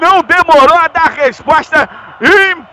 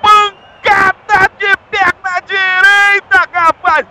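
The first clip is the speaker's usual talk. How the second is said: high excited shouting over music; explosive goal commentary; 4 semitones higher than usual. Real, not acted. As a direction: ride the crowd's roar.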